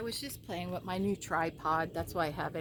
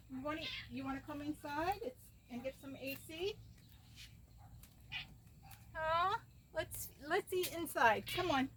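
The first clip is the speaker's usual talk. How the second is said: pet talk; 8 semitones higher, 5 dB quieter than usual. Real, not acted. Inviting pet inside.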